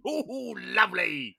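high mum voice, delighted; man doing a woman's voice. Retold or imitated. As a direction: coo like a proud mum. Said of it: "the impression of my mum".

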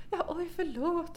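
high, soft, slow apology; apologetic grandmother. Retold or imitated. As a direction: imitate a sheepish grandmother apologizing. (härmad ursäktande farmor, mjuk och hög)

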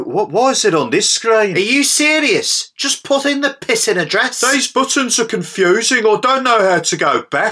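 high, frantic frustration; losing it at the sat nav; exasperated. Acted.

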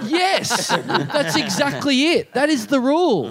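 high, slow, emphatic agreement; drawn-out sarcastic insistence; exasperated confirmation. Acted. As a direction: spell it out in high-pitched exasperation. Exasperated reply to heckler.

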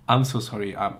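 ordinary talk

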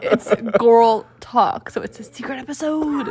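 hushed dramatic secret voice; slow, drawn-out secretive tone. Pretend. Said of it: "Why are you talking like that?".

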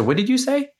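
high-pitched, taken-aback query; retold startled question. Husband turns light on and asks wife, retold incredulous question. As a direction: imitate your own disbelief, voice jumping up.

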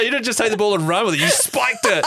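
high laughing delight. Real, not acted. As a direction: laugh through the words. Cracking up at the joke.

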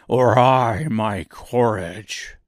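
slow, loud lion lament. A narrator's reading. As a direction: moan it like the lion. Lion's voice.

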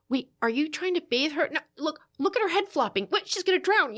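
retold panicky gatekeeping mother; alarmed nagging. Mother hovers as husband bathes baby, alarmed.

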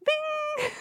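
very high chirpy Bing; a sudden mock Bing sung right up top. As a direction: pop the word out way up high like a cartoon chime. Imitating Dan Aykroyd's ad moment.

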